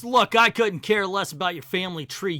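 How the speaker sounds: loud, irritated hero yell; loud, fed-up Superman; impatient yell. Acted. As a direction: cut through the lecture with a shout. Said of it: "Superman yells".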